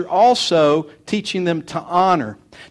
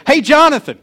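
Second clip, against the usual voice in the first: loud, high mock holler; calling out; 10 semitones higher, 9 dB louder than usual. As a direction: call over to the next kid. Re-enacting calling the next kid.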